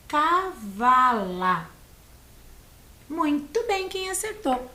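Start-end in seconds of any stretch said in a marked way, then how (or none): 0.1-1.7 Cavala drawn out as CA-VA-LA; syllable by syllable. Shown as a demonstration. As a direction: stretch each syllable of Cavala slowly. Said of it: "três sílabas". (Cavala dito devagar, sílaba por sílaba)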